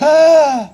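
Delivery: loud, hammed-up shocked gasp; big mock gasp of horror. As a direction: gasp hugely like a scandalized audience. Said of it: "I heard a big gasp".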